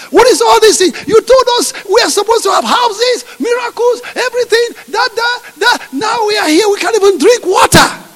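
high shouting grievance; high, loud grievance. Acted. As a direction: list the broken promises, outraged. Preacher voicing Israelites' grievance.

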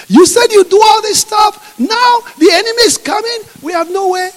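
high, loud accusing shout; panicked shouting crowd. Acted. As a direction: yell at God with the enemy closing in. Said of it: "God is the voice of Moses".

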